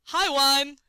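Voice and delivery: a high, loud call for wine; rich shout. A narrator's reading. Yashvin calls the waiter for wine, a ringing high shout. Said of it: "he shouted in his rich voice".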